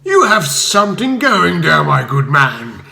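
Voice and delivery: a blustering bigwig forcing a smile; Spode, a forced smile. A narrator's reading. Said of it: "said Spode with what passed for a smile".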